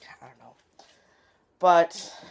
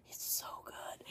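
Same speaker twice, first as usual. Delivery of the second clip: whispered, breathless fangirling; excited whisper; 19 dB quieter, slower than usual. Real, not acted. Fan whispering to viewers about the Rewind video. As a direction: whisper it like a secret.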